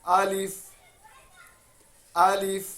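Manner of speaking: अलिफ said short and quick, not drawn out; don't stretch अलिफ, say it quickly; quick correct alif. Shown as a demonstration. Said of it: "जल्दी पढ़ना है".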